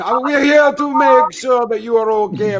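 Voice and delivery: loud, high mock Jamaican accent; imitated Jamaican accent. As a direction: imitate the android putting on a big Jamaican accent. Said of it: "she's doing the accents".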